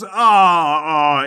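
loud, drawn-out collective "aah"; crowd groan. Acted. Entire crowd upset at the concession, loud imitated groan. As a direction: groan loudly like a let-down crowd. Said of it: "The entire crowd goes".

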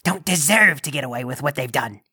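low, measured demon of judgement; deep, deliberate menace; stern Krampus. Acted. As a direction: condemn the sinner in a low, looming voice. Krampus passes judgement on Sprinkle Winker.

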